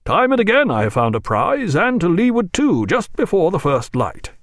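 captain chatting in the barge; lightly voiced cheerful captain; Jack voiced, cheerful.

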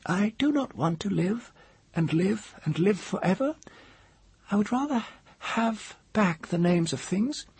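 very high woman's voice, dignified; echo of old dignity. A narrator's reading. Woman facing the mage with old dignity, high-pitched. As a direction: hold yourself proud in a high, measured voice. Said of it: "echo of old dignity in her voice".

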